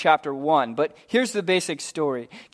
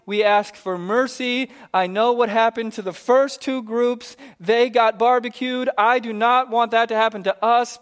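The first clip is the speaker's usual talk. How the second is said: higher, pleading plea to the prophet; frightened captain pleading for his men's lives, higher voice; begging captain; 5 semitones higher than usual. Acted. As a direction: grovel nervously for mercy. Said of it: "He comes begging".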